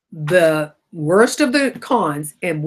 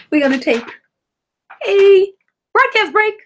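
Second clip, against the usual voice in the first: much higher and louder, a gleeful sing-song; playful announcement; 14 semitones higher, 6 dB louder than usual. Real, not acted. Host joking to listeners, loud gleeful announcement. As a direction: announce it like a big jingle.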